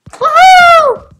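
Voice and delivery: high, drawn-out "woohoo"; excited whoop. Real, not acted. Player cheering after calling everyone to dance, excited whoop.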